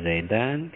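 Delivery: "Zaidan" ending in -an; nasab ending -an. Shown as a demonstration. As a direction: say "Zaidan" with an a before n. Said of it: "ketika dia nasab dibaca". (baca "Zaidan" dengan akhiran -an)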